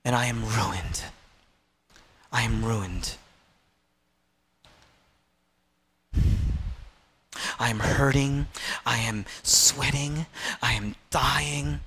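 very low, desolate voice; grave, broken-up statements of suffering; acted despair. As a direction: sink to the bottom of the voice, with long pauses. Voices Jesus ruined and dying.

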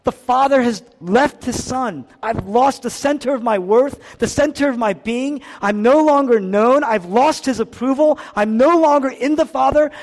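loud, high, insistent outpouring of loss; anguished lament. Acted. Preacher voices Jesus cut off from his Father, anguished lament. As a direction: pile up each loss at full preaching force.

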